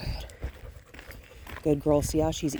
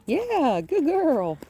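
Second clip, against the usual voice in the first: excited praise; 10 semitones higher, 4 dB louder, much slower than usual. Real, not acted. Praising the dog on a walk.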